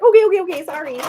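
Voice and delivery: high, loud mock backing down; flustered apology. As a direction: blurt it as if just told off. Acting out a scolded, hurried apology.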